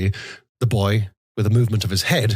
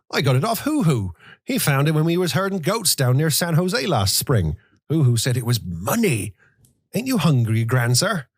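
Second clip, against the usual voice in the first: put-on higher, eager boy's voice; prompt boy answer; 6 semitones higher than usual. A narrator's reading. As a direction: answer quickly like a young boy. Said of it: "answered promptly".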